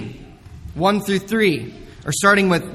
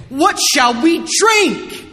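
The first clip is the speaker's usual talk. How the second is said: grumbling Israelites; 8 semitones higher, 3 dB louder, slower than usual. Acted. People grumbling against Moses.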